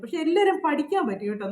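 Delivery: normal talking voice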